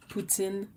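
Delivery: poutine with a nasal "tin" at the end; French Canadian tin part. Shown as a demonstration. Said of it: "but the tin part".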